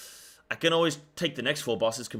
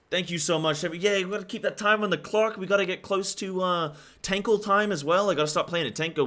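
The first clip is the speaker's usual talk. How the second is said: higher, louder hyped thanks; subathon hype; 7 semitones higher, 4 dB louder than usual. Real, not acted. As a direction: bounce back up with energy. Loud thanks after whisper.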